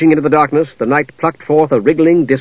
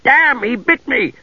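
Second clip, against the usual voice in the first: high, drawn-out yelp; slow, high-pitched cry of a guard just bitten; pained yelp; 11 semitones higher, slower than usual. Acted. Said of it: "One of the guards yelped".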